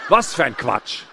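ordinary delivery

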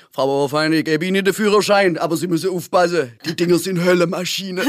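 put-on examiner voice, breaking into a laugh at the end; imitated examiner. Examiner warning the new driver as he grants the licence, comic imitation. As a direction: play the warning examiner, amused. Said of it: "der hat zum Beispiel gesagt".

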